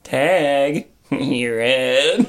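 low, teasing murmur; almost sultry. A narrator's reading. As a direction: purr it low, almost seductively. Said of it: "followed by an almost sultry".

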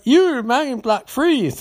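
high-pitched hammed-up order to halt; mock shouted command. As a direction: call out like a movie hero stopping a villain. Acting doctor ordering man to stop.

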